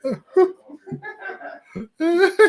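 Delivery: long high laugh almost crying; wheezing cry-laugh. Real, not acted. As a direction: laugh until it squeaks. Laughs hard at friend.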